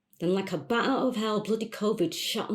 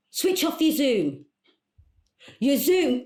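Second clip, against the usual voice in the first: higher, raised instruction; exasperated calling out; 5 semitones higher, 4 dB louder than usual. Acted. Caller instructing coach on failing Zoom, exasperated and raised. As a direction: call it firmly down a bad connection.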